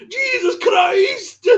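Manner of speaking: high, slow, shouted anguished cry; Welsh anguished cry. Retold or imitated. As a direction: wail it like the burning man. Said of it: "with his thick Welsh accent going".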